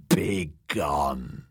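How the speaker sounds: low, croaked hag whisper; croaking whisper. Acted. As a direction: croak it out like an old crone. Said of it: "in a croaking whisper".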